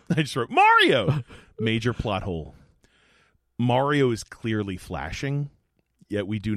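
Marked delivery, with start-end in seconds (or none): 0.5-1.2 excited shout. Retold or imitated. Recalling excited reaction.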